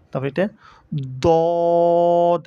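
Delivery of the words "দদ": দদ loud, heavy and long on a level note; demonstrated pronunciation. Shown as a demonstration. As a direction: stretch the rounded vowel of দদ strongly. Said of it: "এটা কিভাবে করতে হবে?". (দদ জোরে লম্বা করে টেনে)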